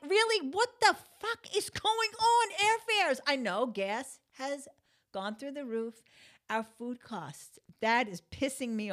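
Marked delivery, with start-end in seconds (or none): 0.1-3.2 high, loud outraged cry; outraged disbelief. Retold or imitated. Retelling her shock at airfares, outraged disbelief. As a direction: shriek in disbelief at the price. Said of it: "I'm like".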